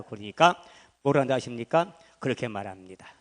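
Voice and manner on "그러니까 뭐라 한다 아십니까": usual speaking voice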